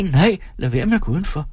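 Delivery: put-on high, childlike woman; small thin voice, pitched well up; childish thin voice. A narrator's reading. Timid audience member answers the performer, childish thin voice. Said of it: "Hendes stemme er barnlig og tynd".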